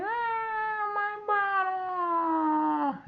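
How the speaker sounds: put-on very high, very slow whimpering toddler; crying baby voice. Old guy crying over the bottle, very high slow whimper. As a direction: whimper like a baby that's lost his bottle. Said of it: "He's crying".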